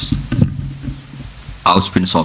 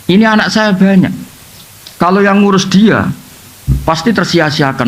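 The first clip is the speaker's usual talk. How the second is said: acted wifely complaint, raised pitch; ranting prayer; 6 semitones higher than usual. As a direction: lay out a grievance to God, high-pitched. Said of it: "pas munajat tuh ya ngawur betul".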